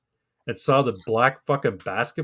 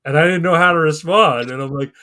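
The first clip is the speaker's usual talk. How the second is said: normal talking voice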